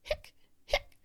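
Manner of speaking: reply in two sharp hics; abrupt acted hiccups. A narrator's reading. Boy replying to cousin, only hiccups. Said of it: "Hector replied".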